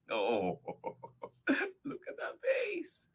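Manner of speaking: ordinary delivery, nothing marked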